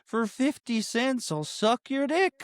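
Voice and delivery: high, slow puppet croon; goofy muffled sock puppet. Retold or imitated. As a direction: stretch it out in a silly high puppet voice. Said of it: "Those beautiful puppet voice".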